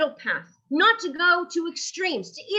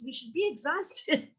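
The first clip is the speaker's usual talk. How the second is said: mock serious; 6 dB quieter than usual. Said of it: "I'm joking".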